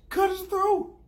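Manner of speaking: very high-pitched imitated command; mock execution order. Arya walks over to order Littlefinger's death, high mock command. Acts out Arya's order to kill.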